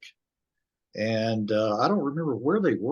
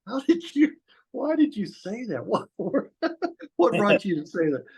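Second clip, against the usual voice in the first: high, incredulous mock asking; baffled question; 7 semitones higher than usual. [name] asking Stiv why he said that, high and incredulous. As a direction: play the stunned friend, pitched way up.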